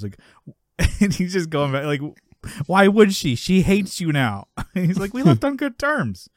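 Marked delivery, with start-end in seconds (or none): 2.7-4.5 louder, higher challenge, acting out a friend's argument; retold incredulous comeback; acted retort. Retelling an acted quarrel. 5.1-6.3 high-pitched mock protest in a retold quarrel; imitating a friend defending himself; defensive protest. Retelling an acted quarrel.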